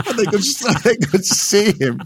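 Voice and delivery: can't hold the laugh in. Real, not acted. Pundit telling the Harry story to co-host, laughing.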